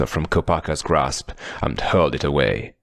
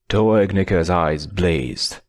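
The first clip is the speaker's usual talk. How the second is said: plain reading voice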